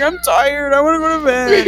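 put-on high, tearful childish whine; mock whining. Exhausted kid back home with grandpa, mock whining. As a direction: whimper it like a worn-out kid. Retold crying child wanting bed.

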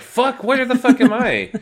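high, loud, panicked squeak; confused droid voice. Retold or imitated. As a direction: flail and squeal like a lost droid. Chopper voiced, wavy arms.

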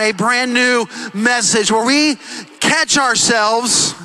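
slow, drawn-out landing; slowed preacher emphasis. Real, not acted. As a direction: stretch the words to land it. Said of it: "preacher slows for emphasis".

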